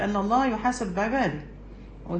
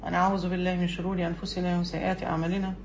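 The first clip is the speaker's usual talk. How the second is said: measured, softer formal recitation of the opening praise; formal sermon opening; 3 semitones lower, 5 dB quieter than usual. Acted.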